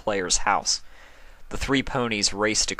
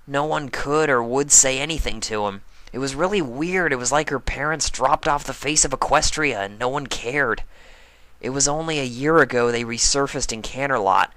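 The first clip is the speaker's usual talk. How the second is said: higher, a bit louder telling; a pony sharing what she heard; lightly voiced tomboy recounting; lightly voiced brash pony; 6 semitones higher, 3 dB louder than usual. Rainbow recounting.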